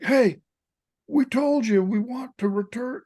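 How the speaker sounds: high, forceful retold reprimand; retold yelling. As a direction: imitate a coach yelling across the field. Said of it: "he's yelling at the guy".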